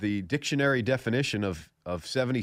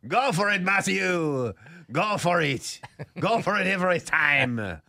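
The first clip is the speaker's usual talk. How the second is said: playful pundit answer; 7 semitones higher, slower than usual. Retold or imitated. Pundit answers his own question, cheering the golfer on. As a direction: egg him on like a fan in the booth. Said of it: "It is funny that you ask me that".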